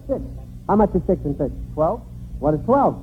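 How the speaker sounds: usual speaking voice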